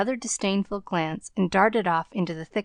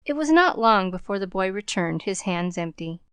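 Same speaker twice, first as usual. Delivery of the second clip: ordinary delivery, nothing marked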